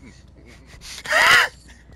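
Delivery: high, loud shriek of panic; panicked scream-laugh. Real, not acted. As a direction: shriek as he closes in. Panicked yelp while chased.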